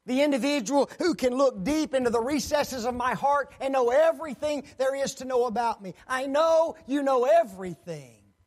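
loud, high earnest appeal; fervent Peter. Acted. Peter addressing the Almighty, loud and high. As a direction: proclaim it before God.

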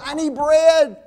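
loud, high call for help; insistent demand. Acted. Man at neighbor's door at night, loud insistent demand. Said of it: "he just gets louder and louder".

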